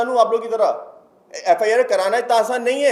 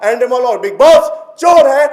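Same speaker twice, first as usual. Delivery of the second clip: shouting accusation; 2 semitones higher, 8 dB louder than usual. Real, not acted. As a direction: yell it out for everyone to hear. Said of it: "चीख चीख के, चिल्ला चिल्ला के बार बार कहूंगा". (चीखते हुए ज़ोर से)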